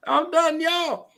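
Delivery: high, loud exclamation; high-pitched pulpit holler; excited preacher. Real, not acted. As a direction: cry out, overcome by the point. Overcome preacher after the punchline.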